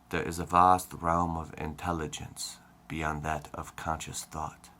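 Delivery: measured, deliberate spiritual-teacher voice; Eckhart Tolle. Acted. Demonstrating how a favorite author talks, Eckhart Tolle impression. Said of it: "he talks like this".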